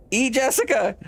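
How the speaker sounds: high, far-off call of a name; calling NPC. Acted. Lodger-owner calls Jessica, high and startled. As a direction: call her name from across the house.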